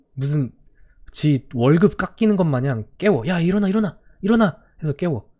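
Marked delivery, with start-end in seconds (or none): 3.3-4.6 mock wake-up call. Teacher waking sleeping students.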